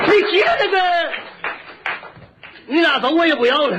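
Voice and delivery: high Shandong outburst, then giving up; exaggerated Shandong shopkeeper; Shandong butcher. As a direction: surrender in tongue-tied Shandong dialect. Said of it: "他这舌头受不了了".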